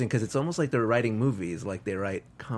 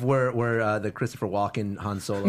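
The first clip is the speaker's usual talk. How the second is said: laughing; 4 semitones lower, 3 dB quieter than usual. Real, not acted. Laughing at the joke.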